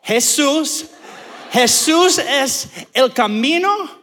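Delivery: slow, emphatic Spanish declamation; going off in Spanish. Retold or imitated. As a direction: preach it in halting Spanish, building. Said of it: "I just start going off".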